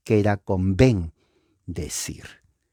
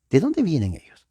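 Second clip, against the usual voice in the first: voz aguda curiosa; 7 semitones higher, faster than usual. Pretend. Diálogo imaginado, curioso.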